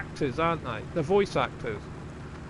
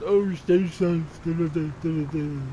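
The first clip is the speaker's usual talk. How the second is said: mocked ad voice. Streamer mocking a virus ad he hates, mocked ad voice. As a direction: parody a virus advert, stretching the opening. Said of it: "All that stupid virus ad".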